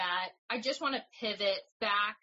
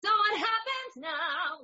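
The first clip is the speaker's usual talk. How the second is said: put-on high, slow little-girl voice; cartoon princess; 11 semitones higher, 5 dB louder, slower than usual. Shown as a demonstration. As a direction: sing it like a tiny princess. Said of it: "a smaller voice".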